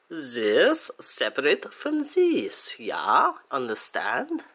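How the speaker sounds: hammed-up German accent, slow and emphatic; mock-German instructor checking you follow; bad German accent. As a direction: put on a shitty German accent. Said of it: "even though it's shitty accent".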